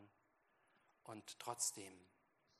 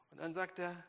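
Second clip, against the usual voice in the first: loud, high, driving sermon voice; full-force preaching; 7 semitones higher, 9 dB louder than usual. Acted. Preacher telling the congregation about Moses, at full force. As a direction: preach it out to the whole hall.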